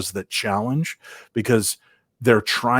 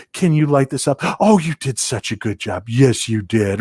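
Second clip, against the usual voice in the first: praising a puppy; 3 semitones higher than usual. Retold or imitated. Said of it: "she treats ghosts like puppies".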